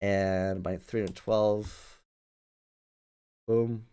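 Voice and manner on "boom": ordinary talk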